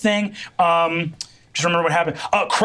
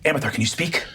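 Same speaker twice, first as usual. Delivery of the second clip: worried; 3 semitones lower, 4 dB quieter, faster than usual. Acted. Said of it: "he goes".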